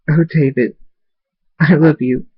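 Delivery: lifted, tender tearful voice; mildly tearful declaration of love; tearful affection. A narrator's reading. Shirley to David as tears appear, mildly tearful. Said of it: "Tears appear in Shirley's eyes".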